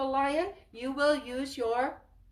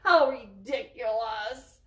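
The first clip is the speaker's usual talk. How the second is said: slow, laughing scoff; 5 dB louder, slower than usual. Acted. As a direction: laugh off the tiny mouse. Lion scoffs at mouse.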